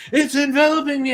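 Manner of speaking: high, loud panicky cry, imitating the investigator; mock panic. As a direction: play the scared investigator yelping.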